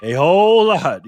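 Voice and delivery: loud, thrilled call; big hype for the board; excited. Real, not acted. Host hypes the big board for chat, excited and loud.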